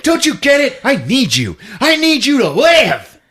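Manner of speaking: high, loud mock begging; hammed-up desperate plea. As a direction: melodramatic villain pleading. Attacker begging the victim.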